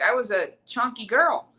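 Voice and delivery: ordinary talk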